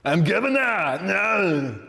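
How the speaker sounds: high, hammed-up action-star impression; comic thick-accented drawn-out wail; Terminator. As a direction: do a knock-off cyborg turned politician. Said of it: "non-copyright infringement Terminator".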